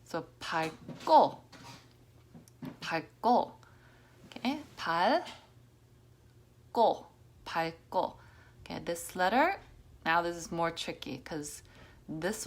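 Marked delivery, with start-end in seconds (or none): 0.4-8.2 밝고 with the G merging into a hard 고; 밝고, no L, just a strong G into 고; G sound. Shown as a demonstration. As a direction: say 밝고 with the G doubled into the next syllable. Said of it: "so it makes G sound".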